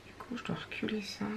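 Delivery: quick whisper to herself. Real, not acted. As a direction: whisper it under your breath, fast. Muttering to herself while crafting.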